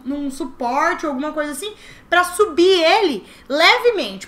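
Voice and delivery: ordinary delivery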